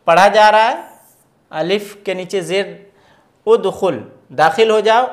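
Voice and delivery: ordinary talk, nothing marked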